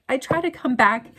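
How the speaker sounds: ordinary delivery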